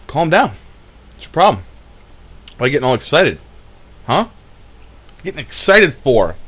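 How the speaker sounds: high, loud, put-on bafflement; hammed-up disbelief in short high bursts; mock exasperation. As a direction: scold them like an exasperated critic. Mimicking critics dismissing people's alarm.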